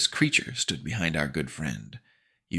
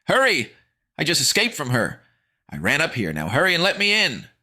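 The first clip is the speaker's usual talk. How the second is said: high, loud pleading; desperate high cry; frantic whimper; 12 semitones higher, 7 dB louder than usual. A narrator's reading. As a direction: plead urgently from behind a door. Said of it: "Fancy Pants whimpered".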